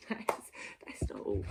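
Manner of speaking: breathy stage whisper, low and hushed; whispered aside. Pretend. Vlogger whispers a teasing aside to viewers. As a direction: lean in and whisper it like a secret.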